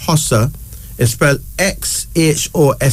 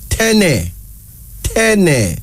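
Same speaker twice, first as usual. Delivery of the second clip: ordinary delivery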